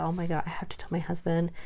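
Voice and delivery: hushed, quick whisper to herself. Retold or imitated. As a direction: whisper it fast, half panicked. Whispered thought about telling husband.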